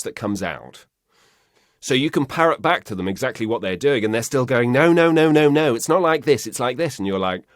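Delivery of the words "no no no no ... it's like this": high, loud string of corrections; mock insistent client. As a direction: imitate a client impatiently correcting you. Voices client insisting on line reading.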